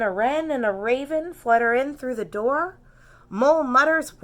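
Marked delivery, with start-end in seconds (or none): none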